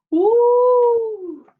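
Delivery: high, loud sustained "woo"; howl. A narrator's reading. Falling coyote in a children's tale, long high howl. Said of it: "he howled".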